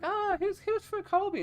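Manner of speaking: put-on very high girly voice; mock girl voice. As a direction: squeak it like a nosy girl. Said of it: "she's like".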